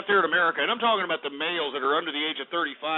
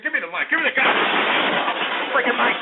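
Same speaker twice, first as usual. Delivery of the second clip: louder, strained, half-breathed demand; yelled demand; 4 semitones higher, 3 dB louder than usual. Acted. Host demanding the mic from crew, strained yell. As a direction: grab for it, snarling.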